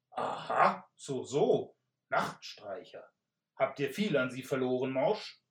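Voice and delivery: pompous knight. Acted. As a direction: question him like a self-important knight. Knight questions freed captive.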